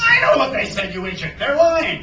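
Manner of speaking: loud, high captain's outburst; irritated retort. A narrator's reading. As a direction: berate the doubting first mate. Hook snaps at doubting Smee.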